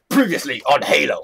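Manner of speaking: low show-recap announcer; lightly put-on recap voice; intro announcer. Shown as a demonstration. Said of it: "like an intro? Be like".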